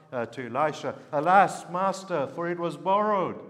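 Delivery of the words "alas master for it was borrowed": higher, louder desperate cry to the master; despairing cry. A narrator's reading. Young prophet crying out to Elisha over the lost axe, desperate and loud. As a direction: cry out like a man who has lost everything. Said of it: "in despair, he cries out".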